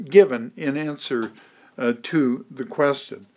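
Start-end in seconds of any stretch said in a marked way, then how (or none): none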